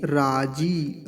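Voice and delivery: राजी with a long drawn-out जी; long ee in raji. Shown as a demonstration. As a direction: stretch the ई of राजी. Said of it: "इसको लंबा खींचना है". (राजी में लंबी ई)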